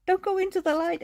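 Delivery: high mock-spooky quote; high teasing warning; joking movie quote. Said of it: "it's just like a joke".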